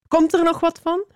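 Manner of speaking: high-pitched, impatient nagging; impatient demand. A narrator's reading. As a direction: hurry them up, pitched high. Figure in well demands help. (ongeduldig, hoog aandringen)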